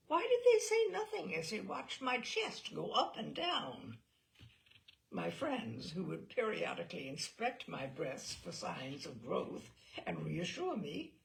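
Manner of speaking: ordinary delivery, nothing marked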